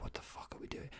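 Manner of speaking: very quiet mock-baffled whisper; whispered disbelief. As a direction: whisper it as an aside. Acted baffled reaction in whisper.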